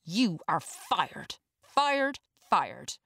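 furious dismissal; angry firing. A narrator's reading. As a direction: spit out the firing in a rage. Firing the guard in rage.

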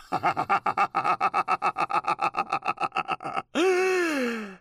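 long forced fake laugh. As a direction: trademark forced chat show laugh. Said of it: "trademark forced laughter".

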